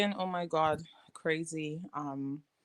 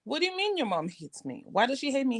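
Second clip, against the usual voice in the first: a touch higher, puzzled questions; retold surprise; 6 semitones higher than usual. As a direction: press the child for an answer. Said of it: "And I was like".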